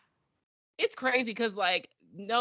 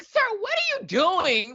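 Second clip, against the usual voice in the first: much higher, louder, slow disbelief; incredulous; 4 dB louder, slower than usual. Retold or imitated. Reacting to Nathan grabbing cocoa, high and incredulous. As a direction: stretch it out in total disbelief.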